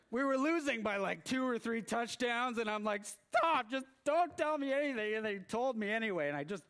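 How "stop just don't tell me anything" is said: much higher, louder begging stop; desperate plea. Retold or imitated. Fan to coworkers, a loud desperate retold plea. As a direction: plead with them to stop.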